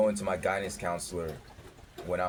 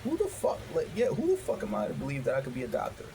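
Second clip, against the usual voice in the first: incredulous self-doubt; 7 semitones higher than usual. Retold or imitated. Doubting himself after the meeting, shrill disbelief. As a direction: ask it as if the idea is absurd.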